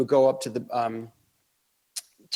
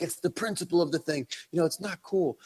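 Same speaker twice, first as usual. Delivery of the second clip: irate; 6 semitones higher than usual. Retold or imitated. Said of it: "my friend Tim is irate".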